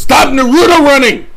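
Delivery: loud sharp shout, pitch way up; yelling. Real, not acted. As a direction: yell it across the house. Yelling at wife.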